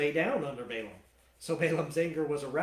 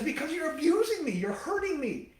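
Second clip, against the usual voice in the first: high mock angry Balaam; 10 semitones higher, 3 dB louder than usual. Balaam angry at talking donkey.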